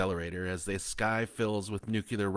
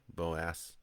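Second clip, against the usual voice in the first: usual speaking voice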